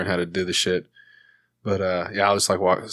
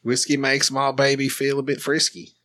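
lilting Australian lyric recital; country song spoken as an Australian; Australian accent; 3 semitones higher than usual. Shown as a demonstration. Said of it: "I just practice singing songs in Australian".

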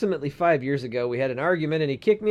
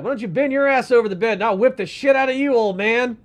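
mock threat to dad; 5 semitones higher, 4 dB louder than usual. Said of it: "I wanted to be like".

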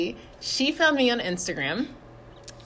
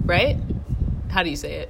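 ordinary delivery

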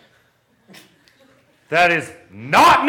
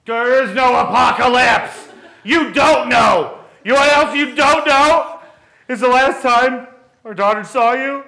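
angry shout; 7 semitones higher, 5 dB louder, slower than usual. Acted. Angry parents' quarrel.

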